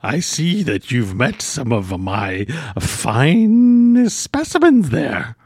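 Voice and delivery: slow, dreamy hermit; proud collector of mushrooms; eccentric hermit voice. Acted.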